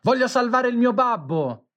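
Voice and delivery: high, desperate shout; desperate cry. A narrator's reading. Boy leaping into the sea for his father, desperate high cry. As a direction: fling the words out as he leaps in. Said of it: "un urlo disperato ... gridando".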